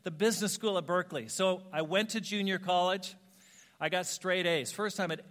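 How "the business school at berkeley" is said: determined. Retold or imitated.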